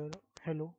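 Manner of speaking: ordinary talk, nothing marked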